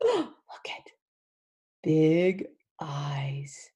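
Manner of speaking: hushed unvoiced whisper. Teacher whispering to the class about the monkey's eyes. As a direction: breathe it like a secret.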